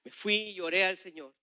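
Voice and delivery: ordinary delivery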